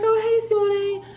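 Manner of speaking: high-pitched, friendly phone hello; imitated chirpy office lady; cheery HR greeting. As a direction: greet her brightly, pitch way up. Voices HR lady's friendly greeting.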